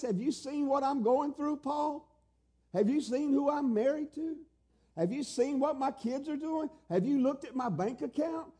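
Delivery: high-pitched piled-up questions; incredulous protest. Acted. Believer listing troubles to Paul, incredulous. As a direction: challenge him in mounting disbelief, pitched high. Acted protest to Paul's command.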